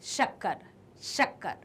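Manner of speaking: "शक्कर" with a clear hushing sh; palatal sh. Shown as a demonstration. Teacher giving an example word, "शक्कर" with palatal sh. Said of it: "अब बात की जा रही है इसकी".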